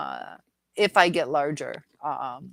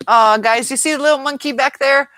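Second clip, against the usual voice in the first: much louder and higher, thrilled; excited call; 9 semitones higher, 13 dB louder than usual. Real, not acted. Creator excitedly points out her dog to viewers, loud. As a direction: call the viewers over to look.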